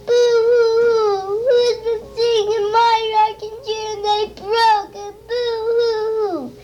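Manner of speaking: high, hammed-up crying cub; little bear wailing about his broken chair; mock crying. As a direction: sob it out like a baby bear. Baby Bear crying over chair.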